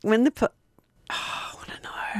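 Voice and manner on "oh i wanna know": breathy hushed whisper. Real, not acted. Co-host whispering so the listener misses it. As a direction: whisper it as a secret aside.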